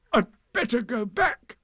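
high, loud, flustered bear; high-pitched effortful fluster; strained distress. A narrator's reading. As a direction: say it struggling to wriggle free. Pooh struggling in the hole.

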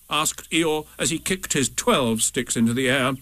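plain reading voice

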